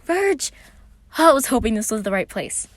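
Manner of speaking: high, loud relieved greeting; glad call. A narrator's reading. Patton greeting his brother from the car, relieved and loud. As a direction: burst out happy to see him. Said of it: "Patton called from the Virgil seat".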